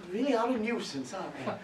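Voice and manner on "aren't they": usual speaking voice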